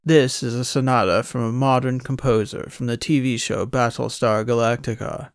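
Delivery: pretentious mock posh accent; imitating her put-on, old-movie posh accent. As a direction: lecture him in an affected fancy accent. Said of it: "that collection of words in that put-on accent".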